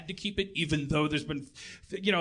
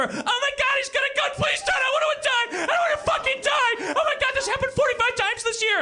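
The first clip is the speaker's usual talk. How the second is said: much higher, loud hammed-up panic; mock terrified scream; 4 dB louder than usual. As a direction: shriek like a bad horror victim. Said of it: "all of a sudden it's like".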